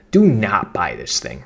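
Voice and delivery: slow, punched "Do not" warning; stress on Do not. Shown as a demonstration. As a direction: hit "Do not" hard and slow. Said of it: "the main point is you have the hook".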